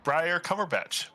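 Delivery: high, slow name. Acted. Player gives name in character.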